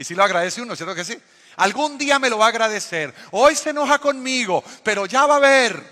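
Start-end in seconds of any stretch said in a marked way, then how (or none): 1.6-5.8 loud, higher stern dad warning; playful dad voice, pushed up and louder; mock father lecture. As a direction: wag the finger like a lecturing father. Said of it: "El discurso de mi papá era".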